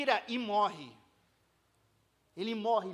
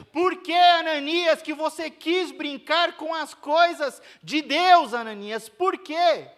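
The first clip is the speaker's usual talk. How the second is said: higher, louder, slow accusing questions; Peter's stern rebuke, raised and slow; indignant rebuke; 6 semitones higher, 7 dB louder than usual. Acted. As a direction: demand an answer from him. Peter confronts Ananias.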